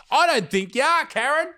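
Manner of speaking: high, sarcastic sing-song, rising at the end; mocking disbelief. Real, not acted. Mocking a commenter's claim.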